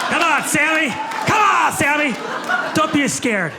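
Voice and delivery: high loud coaxing call; coaxing shout. Real, not acted. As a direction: egg him on loudly. Coaxing audience member loudly.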